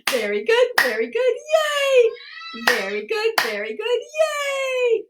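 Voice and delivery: rising words into a very high, stretched whoop; celebratory cheer. Pretend. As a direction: chant it twice, each capped by a squealed yay. Group cheer closing laughter exercise.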